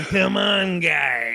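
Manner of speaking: drawn-out catchphrase shout; stage catchphrase. Retold or imitated. Comic recalling his own bit on stage, catchphrase shout. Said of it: "I'm on stage going".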